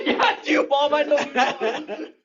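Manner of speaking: very high, squeaky reply; hammed-up upset child. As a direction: play a distressed kid answering mom. Acted indignant reply to mom.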